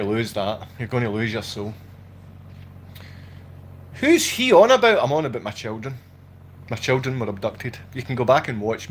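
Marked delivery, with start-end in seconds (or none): 3.9-5.0 high, loud mimicry of a baffled onlooker; mock-baffled heckler, pitched well up; mocking viewer's question.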